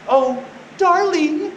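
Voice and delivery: high, drawn-out mock sweetheart; coy flirt. Delilah sweet-talking Samson, coy and high-pitched. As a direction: coo it slowly like a flirting woman. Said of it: "batting her eyes like we all know".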